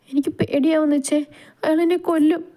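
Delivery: high-pitched, fearful plea; frightened wife. Acted. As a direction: voice a scared woman clinging to her husband. Frightened wife voiced in story. (പേടിച്ചരണ്ട പെണ്ണിന്റെ ഉയർന്ന സ്വരം)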